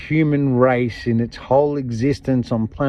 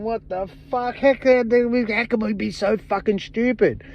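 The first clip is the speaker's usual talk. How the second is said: higher, indignant mock outburst; imagined outraged people; 7 semitones higher than usual. People realising they were fooled, higher indignant mock rant. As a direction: voice the public's disbelief, pitched up. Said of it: "people out there going".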